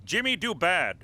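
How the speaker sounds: mock simple speech for a dimwit; dumbed-down talk. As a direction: talk down to him in broken English. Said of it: "Speak American".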